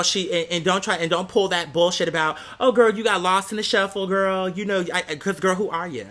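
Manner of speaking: ordinary talk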